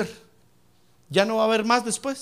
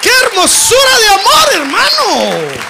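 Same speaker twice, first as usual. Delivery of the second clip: shouted praise, high and loud; preaching at full force; 11 semitones higher, 12 dB louder, slower than usual. Real, not acted. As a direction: cry out the praise to the congregation.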